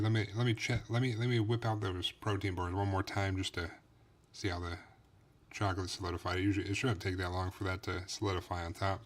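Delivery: ordinary talk, nothing marked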